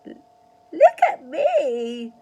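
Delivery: put-on high, loud and slow old woman's shout; drawn-out shrill granny yell; Grandma shouting. A narrator's reading. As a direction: wail for attention from the roof. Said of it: "Grandma shouted from the rooftop".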